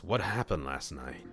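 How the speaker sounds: plain reading voice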